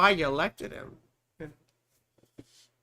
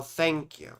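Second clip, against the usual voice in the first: ordinary talk, nothing marked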